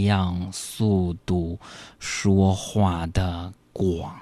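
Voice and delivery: sloth. Pretend. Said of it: "用树懒的方式来说话".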